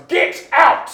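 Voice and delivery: furious boss shout; yell. Acted. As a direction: throw them out. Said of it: "as he just yells".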